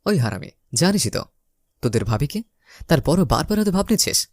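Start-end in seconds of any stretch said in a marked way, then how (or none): none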